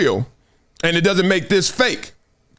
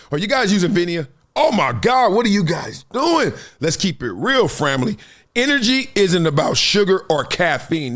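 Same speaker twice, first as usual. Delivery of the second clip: softer, pitched sponsor pitch; a hyped-up host ad read; 3 semitones lower, 3 dB quieter than usual. Acted. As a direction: sell it to the listeners.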